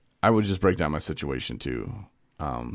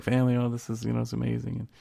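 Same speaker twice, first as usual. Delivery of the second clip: mock gushing; 6 semitones higher, 3 dB quieter than usual. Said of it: "You're like".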